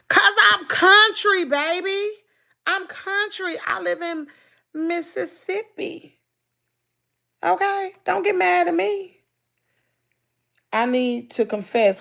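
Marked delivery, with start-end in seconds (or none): 0.1-9.2 high-pitched, drawn-out country excuse; playful country drawl. Pretend. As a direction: plead your case high and slow, country style. Mock-pleading answer about slow speech.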